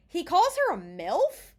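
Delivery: loud, high incredulous question; appalled disbelief. Real, not acted. Podcaster recapping the villain's crude remark, appalled disbelief. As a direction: squawk it as an outraged question.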